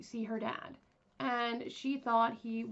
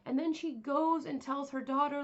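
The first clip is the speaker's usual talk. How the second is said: usual speaking voice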